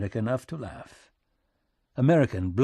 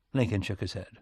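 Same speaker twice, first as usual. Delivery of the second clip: ordinary delivery, nothing marked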